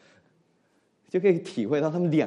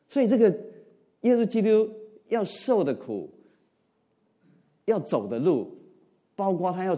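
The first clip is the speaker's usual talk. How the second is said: much higher and louder, slowing on the suffering; emphatic preaching; 9 semitones higher, 5 dB louder than usual. Acted. Pastor stresses what Jesus had to suffer, emphatic preaching. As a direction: lift the voice to stress Christ's suffering.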